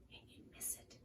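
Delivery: breathy whispered afterthought. Acted. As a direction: drop to a whisper as if it's gone. Dramatic read-aloud moment.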